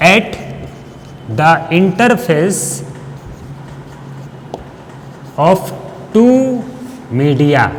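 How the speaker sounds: one or two words, then wait; piecemeal, as if for copying down; slow dictation. Real, not acted. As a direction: dictate unhurriedly with long pauses. Teacher continues dictating for notes.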